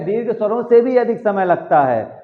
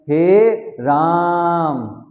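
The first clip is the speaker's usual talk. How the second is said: राम stretched long after हे; very slow, drawn-out हे राम; stress on राम; much slower than usual. Shown as a demonstration. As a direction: lean into राम and hold the vowel. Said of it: "राम को जोर देकर बोल रहे हैं".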